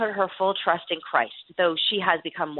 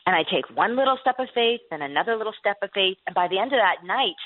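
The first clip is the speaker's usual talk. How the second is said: usual speaking voice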